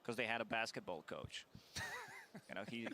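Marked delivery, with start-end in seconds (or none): 1.5-2.4 high squeaky giggle at own joke; corny dad laugh. Real, not acted. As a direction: cackle at your own pun. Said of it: "I'm just laughing at my own joke in my head".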